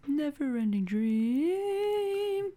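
very slow, high, soft drawl; breathy mock-mystical title; dreamy echo. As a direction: stretch it out like a dream.

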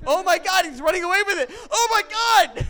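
high, loud, laughing shriek of disbelief; laughing high-pitched Oh my God as the projector is stolen; excited shriek. Real, not acted. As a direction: yelp it out as he runs off laughing. Projector being run off with.

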